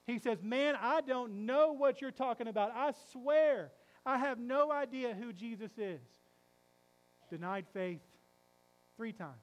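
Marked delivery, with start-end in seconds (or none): 0.1-6.0 louder, heated oath of denial; angry cursing denial. Acted. Peter cursing at the man who recognizes him, loud and upset. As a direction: swear it off, upset and cornered. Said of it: "Peter gets really upset".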